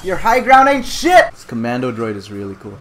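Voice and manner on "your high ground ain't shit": loud, raised trash talk; shouted taunt. Pretend. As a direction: crow over the enemy in the game. Taunting game enemy.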